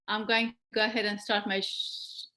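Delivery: ordinary talk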